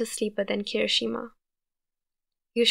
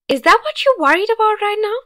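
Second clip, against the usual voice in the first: much higher, loud disbelieving yell; incredulous yell; 11 semitones higher, 10 dB louder than usual. A narrator's reading. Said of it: "and then yell".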